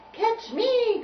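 high, loud playful call; little tune crying out. Acted. Little tune teasing Tubby, high cry. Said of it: "cried the little tune".

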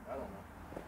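ordinary delivery

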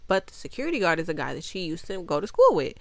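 normal talking voice

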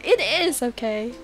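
ordinary talk

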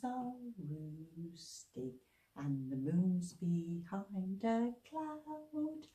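slow soft whisper. Shown as a demonstration. Leader whispering children back to sleep. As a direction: hush them back to sleep.

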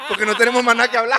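high, loud, fast talk through laughter; laughing. Real, not acted. Joking with the co-host, speaking through laughter. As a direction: crack up while talking.

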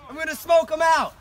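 shouted call. Acted. Calling out in battle.

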